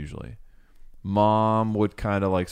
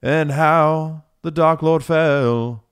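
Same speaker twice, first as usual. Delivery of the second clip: slow, ominous storyteller, rising louder; horror host; 4 semitones higher, 4 dB louder, much slower than usual. Pretend. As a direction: gather the children for a dark tale. Mock bard telling a dark tale.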